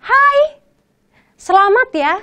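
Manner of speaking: high, bright birthday greeting; a friend cheerfully wishing happy birthday. Shown as a demonstration. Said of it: "So it means".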